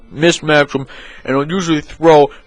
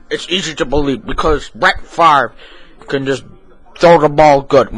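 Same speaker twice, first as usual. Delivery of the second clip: ordinary talk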